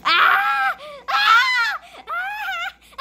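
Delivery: repeated high frightened screams; panicked screams. Real, not acted. Player screams while fleeing a chaser, high shrieks. Being chased around the room.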